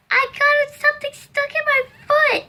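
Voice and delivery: put-on high, whiny sobbing child voice; imitating her crying self. Hurt girl tells her cousins about her foot, high whimpering.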